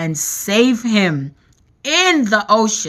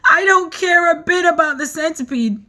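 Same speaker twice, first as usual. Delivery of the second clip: sobbed; 8 semitones higher, faster than usual. A narrator's reading. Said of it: "the earthworm sobbed".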